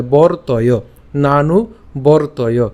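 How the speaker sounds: বর্তয় slowly as bor-toyo, র closing the first syllable; slow demo of বর্তয় with র attached to ব; reph joined to ব. Shown as a demonstration. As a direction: draw out নানু বর্তয়, breaking after bor. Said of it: "ব এর সাথে উচ্চারণ হয়ে যাবে".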